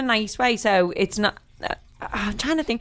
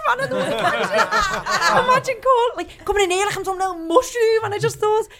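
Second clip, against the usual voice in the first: laughing; 10 semitones higher than usual. Real, not acted. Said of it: "laughing while retelling".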